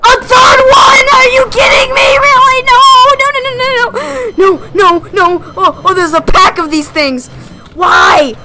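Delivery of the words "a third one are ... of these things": panicked wail. Real, not acted. Third monster appears.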